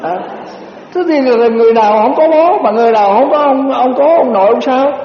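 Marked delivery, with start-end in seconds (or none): none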